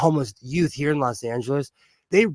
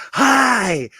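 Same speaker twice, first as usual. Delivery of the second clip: excited greeting; 8 semitones higher, 5 dB louder than usual. Retold or imitated. Modelo Man greeting her happily.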